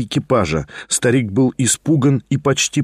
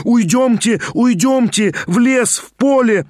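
frantic pleading; 9 semitones higher than usual. A narrator's reading. Liza frantic, running off.

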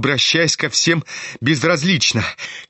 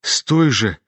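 usual speaking voice

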